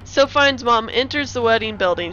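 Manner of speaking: usual speaking voice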